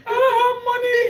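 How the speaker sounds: high, loud wail with a sob; hammed-up crying plea, high and loud; mock crying. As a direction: cry it out, half laughing. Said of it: "I said, I cried".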